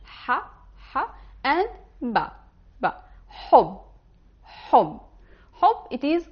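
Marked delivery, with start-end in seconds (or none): 0.0-2.3 letter-by-letter teacher demo; sounding out letters. Shown as a demonstration. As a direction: spell out the sounds Ha, ha, ba. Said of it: "check how to pronounce ha".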